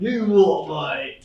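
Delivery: slow drawn-out put-on voice. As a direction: stretch it in a silly voice.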